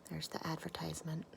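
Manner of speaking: quick whisper about the picture; whispered aside. Real, not acted. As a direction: whisper it, pointing at the page. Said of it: "aside pointing at picture".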